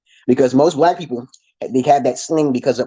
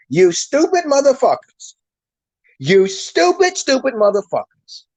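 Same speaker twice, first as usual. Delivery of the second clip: high, slow scornful jeer; contemptuous insult; 9 semitones higher than usual. Real, not acted. Vlogger scolding his audience, high scornful jeer. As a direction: sneer "stupid" at the viewers.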